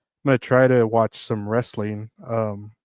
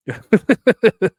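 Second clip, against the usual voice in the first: burst of laughter; 10 semitones higher, 7 dB louder than usual. Real, not acted. Laughing at headline.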